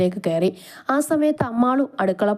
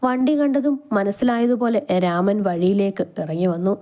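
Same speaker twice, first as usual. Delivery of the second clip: ordinary delivery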